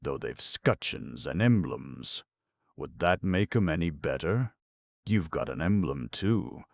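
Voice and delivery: usual speaking voice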